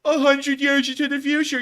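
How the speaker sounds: loud, high, drawn-out mock complaint; mock them slowly and shrilly high; mocking critic. Imitating rude commenters about the video, mocking. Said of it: "in a mocking tone".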